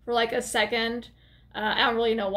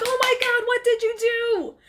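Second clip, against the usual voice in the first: high-pitched hammed-up alarm; mock panic; 12 semitones higher, 4 dB louder than usual. [name] reacts to the head in the bag, hammed-up panic. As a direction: freak out in a put-on high voice.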